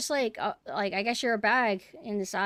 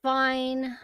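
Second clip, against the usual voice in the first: normal talking voice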